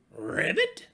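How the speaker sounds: high, loud croak; practiced ribbit. Acted. Little pig practicing his frog call, high and loud.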